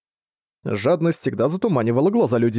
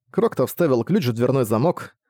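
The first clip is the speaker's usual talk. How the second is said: ordinary talk, nothing marked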